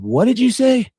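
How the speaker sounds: loud, high demanding question; retold demand. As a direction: snap it at him, outraged, as retold. Confronting Larry over his lie.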